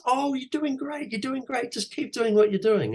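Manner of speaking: very high, gushing falsetto; mock an over-enthusiastic director who loves everything; squealing exaggerated praise; gushing praiser. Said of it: "And you're like, "Can I get some notes?".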